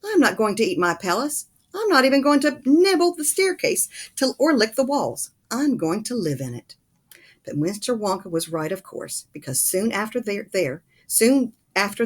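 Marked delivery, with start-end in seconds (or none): 0.0-6.8 loud, higher haughty prince; a proud prince scoffing; raised, high royal indignation; indignant prince. A narrator's reading.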